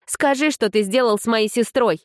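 high, urgent demand; urgent young woman. Acted. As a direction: press him hard, voice up high. Kidan demands her sister's fate.

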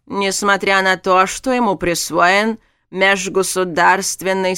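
slow, loud, formal pronouncement; stern official. A narrator's reading. Guild mage halts the tournament.

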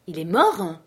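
"Il est mort?" thrown out as an almost-scream; near-shout of shock. A narrator's reading. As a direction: nearly howl the question in disbelief. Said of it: "Je poussai presque un hurlement".

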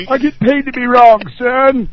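high, loud comeback; mock-indignant shout. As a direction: holler it back as a comic retort. Comic comeback after being called wrong.

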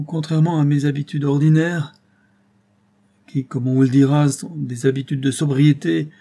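put-on old Maldon reproaching Robert for frightening him; indignant old drunkard. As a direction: scold him, faltering and stammering. Old man's indignant tirade.

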